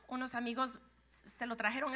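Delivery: ordinary talk, nothing marked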